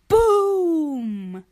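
loud "Boom!" of impact; crash boom. Acted. Narrator voicing the asteroid crash, loud. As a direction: smash the word like a collision.

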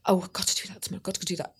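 anxious inner thoughts. Retold or imitated. Retold racing night thoughts.

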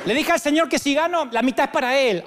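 a giddy believer bargaining with God; gambler's boast. Retold or imitated. Hermanito at Las Vegas boasting his deal with God, laughing. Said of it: "encontré unos cuantos hermanitos que estaban".

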